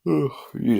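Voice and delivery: sleepy, yawning through words. Real, not acted. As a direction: talk while fighting a yawn. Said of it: "I'm getting way too many yawns".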